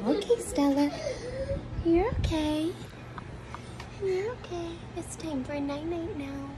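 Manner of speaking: calm her down gently at bedtime. Real, not acted. Mother soothing crying toddler.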